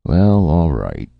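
slow, loud and woozy; dizzy. A narrator's reading. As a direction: say it tipsy and dizzy. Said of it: "eniac said dizzily".

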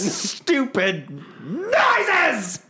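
stretched slow and high; whiny drawn-out protest; drawn-out mock complaint. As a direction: drag the words out in mock outrage. Mocking the noises.

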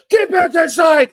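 very high, slow full-out shout; shouted cop order. Retold or imitated. Cop ordering the manager inside, imitated loud shout. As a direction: yell the command at high pitch.

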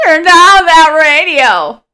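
cranky neighbor yelling. Pretend. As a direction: holler like an annoyed old neighbor. Neighbor yelling about music.

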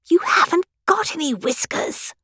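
a touch of a blunt queen putting him right; Queen flatly correcting the King; the Queen, tart. Acted.

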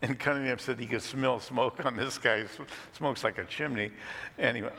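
ordinary delivery

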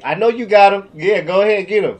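loud, cheering mom voice; mom egging on. Retold or imitated. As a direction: urge them on like a proud mom. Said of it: "My mom would leave me at school and be like".

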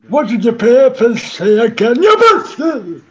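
put-on loud old Geordie dad, shouting; Geordie old father. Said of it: "your father, Geordie Geriatric".

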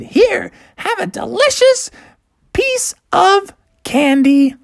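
high, drawn-out hostess voice; lizard mother offering. Acted. Lizard mother hands out candy.

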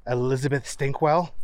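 plain reading voice

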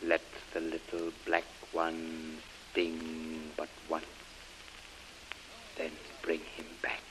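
fast hushed whisper. Acted. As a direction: rush it out in a whisper. Priest ends secret murder orders.

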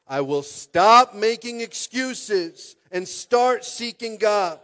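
loud, slow, high vow, every word pressed; declared loud. Shown as a demonstration. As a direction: proclaim it like a battle cry. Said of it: "We're gonna say it again right here".

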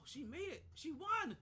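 excited. Real, not acted. Reacting to a win on screen.

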